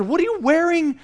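high-pitched incredulous question; mock disbelief. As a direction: question the outfit like an appalled dad. Retold dad challenging his kid's shirt.

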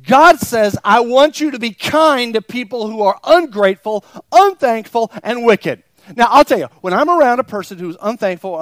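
full-throated preaching; preaching at full force. Acted. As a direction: hammer it to the congregation. Fervent preaching continues.

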